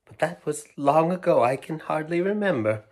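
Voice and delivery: a touch of sadness, remembering; sad. Acted. Old woman telling children, mildly sad. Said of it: "She looked at them sadly".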